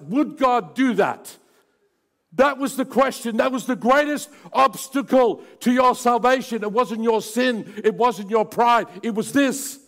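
loud, high, impassioned preacher; preaching at full force. Acted. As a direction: thunder it out to the congregation.

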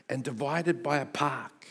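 ordinary delivery

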